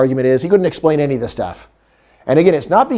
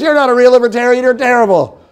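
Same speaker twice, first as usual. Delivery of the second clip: higher, louder, fast online rant; mock screaming; 9 semitones higher, 3 dB louder than usual. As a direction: imitate a furious purist shouting down a rival. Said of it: "Screaming at other libertarians".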